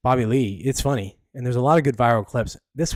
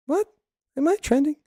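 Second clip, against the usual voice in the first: high incredulous "am I trending?"; a startled, high-pitched double take; surprised. Real, not acted. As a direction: caught off guard by trending news.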